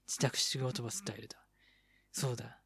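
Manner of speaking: soft unvoiced whisper. Shown as a demonstration. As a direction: repeat it in a whisper.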